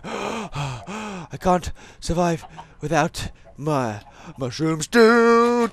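slow hammed-up dying plea; mock despair. Gamer hungry in-game begging for mushroom stew, hammed-up slow despair. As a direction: drag it out like a starving hero.

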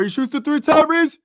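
very high, loud call of the last shot; excited shout. Real, not acted. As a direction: yell it as the ball flies. Final shot in the air.

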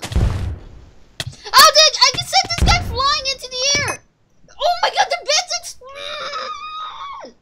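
high, loud, laughing shout; excited. Real, not acted. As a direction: yell it out in delight. TNT sends player flying.

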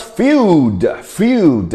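"field" slowly and drawn out; pronunciation demo. Shown as a demonstration. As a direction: say "field" as a careful model word. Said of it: "How to pronounce field?".

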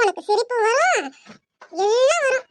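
ordinary talk, nothing marked

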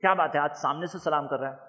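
low, soft sarcastic remark; imitating a cynic, low and hushed; sarcastic suspicion. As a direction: grumble it suspiciously under the breath. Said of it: "بولے".

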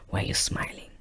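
hushed, wary question; uneasy whisper. Retold or imitated. Girlfriend unnerved by his silent smile, whispering. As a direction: whisper it, unsettled by him.

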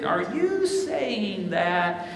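slow, drawn-out disbelieving question; incredulous challenge. Retold or imitated. Church member questioning the preacher after Sunday sermon, drawn-out disbelief. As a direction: stretch the words out, imitating a doubtful listener.

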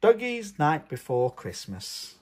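ordinary delivery, nothing marked

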